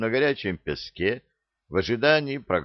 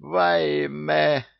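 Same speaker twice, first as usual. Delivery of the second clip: high, loud cry of dismay; 9 semitones higher, 4 dB louder than usual. A narrator's reading. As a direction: clutch your head and cry out. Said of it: "схватился за голову благочестивый визирь".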